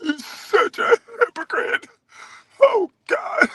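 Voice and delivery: high, drawn-out tearful whine; hammed-up wounded falsetto, very slow; mock a man crying in a girlish voice; mock-feminine impression. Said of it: "sounding feminine than a mug".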